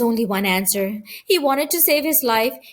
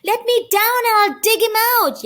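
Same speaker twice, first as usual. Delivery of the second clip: much higher, pleading little dog voice; dog begging its owner to put him down; yapping terrier; 10 semitones higher, 3 dB louder than usual. A narrator's reading. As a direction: yap it like a terrier being carried off. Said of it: "yapped the dog".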